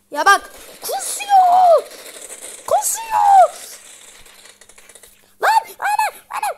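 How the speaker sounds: very high, loud squeal; squealing panic. Real, not acted. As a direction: shriek it out in gamer panic. Squealing at gameplay mishap.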